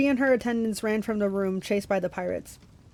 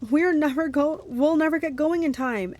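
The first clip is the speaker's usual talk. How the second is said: higher, urgent yell; 6 semitones higher than usual. A narrator's reading. Annabeth yelling while climbing aboard. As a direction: shout it in a panic. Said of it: "Annabeth yelled".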